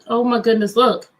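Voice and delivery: ordinary delivery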